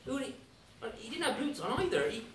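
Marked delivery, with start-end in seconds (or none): none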